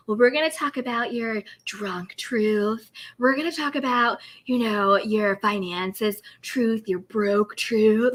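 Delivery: slow, dry, unimpressed reading. Retold or imitated. Giselle reading Karen's finances, slow and dry. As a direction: imitate a deadpan, slow read. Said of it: "with her dry reads".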